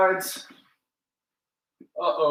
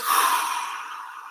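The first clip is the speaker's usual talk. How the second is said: long breathy sigh out through the mouth; demonstration exhale; 4 dB quieter than usual. Shown as a demonstration. Coach showing the class how to breathe out, with an audible exhale. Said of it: "I want those breaths to be into the nose, out the mouth".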